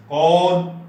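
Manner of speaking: कौन with a long open aw, loud; Hindi औ sound. Shown as a demonstration. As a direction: hold the vowel of कौन as kawn. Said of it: "औ करते हैं". (कौन में खुला लंबा 'ऑ', ज़ोर से)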